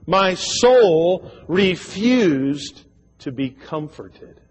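very slow, weighted scripture read; slow solemn reading. Real, not acted. Preacher stressing the last phrase, very slow. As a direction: linger on every word. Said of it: "don't miss this last phrase".

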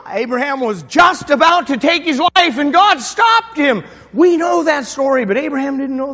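fiery pulpit storytelling; full-force preaching. Acted. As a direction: drive the story home to the congregation. Preaching climax.

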